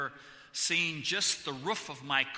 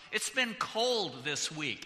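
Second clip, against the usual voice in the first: ordinary delivery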